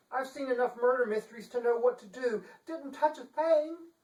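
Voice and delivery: high, prim lady voice; fussy Chancellor. Acted. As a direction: act the shocked, genteel Chancellor.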